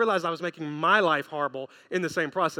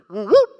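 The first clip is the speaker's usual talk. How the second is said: loud imitated siren chirp; sudden mimicked siren burst; police siren whoop; 12 dB louder than usual. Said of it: "a sound of a policeman".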